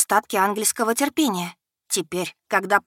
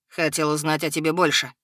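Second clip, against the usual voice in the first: low menacing dragon; 4 semitones lower than usual. A narrator's reading.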